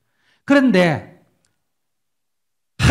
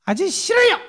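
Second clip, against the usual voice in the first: put-on high childlike "아저씨 싫어요"; child's refusal; 4 dB louder than usual. Preacher imitates a Seoul schoolchild refusing him in a high voice. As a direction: mimic a little Seoul kid saying no. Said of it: "서울 애가 초등학생이니까".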